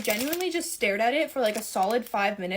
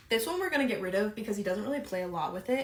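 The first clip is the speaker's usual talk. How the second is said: normal talking voice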